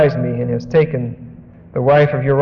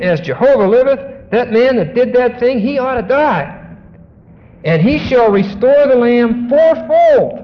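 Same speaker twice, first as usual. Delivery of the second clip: David's indignant verdict; 7 semitones higher than usual. Acted. Preacher voicing David condemning the rich man, indignant. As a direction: pronounce the judgment with hot indignation.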